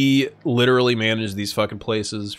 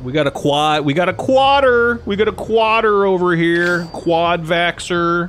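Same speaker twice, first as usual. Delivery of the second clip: mock hype; 7 semitones higher, 4 dB louder than usual. Mocking a quadruple-vaxxed friend.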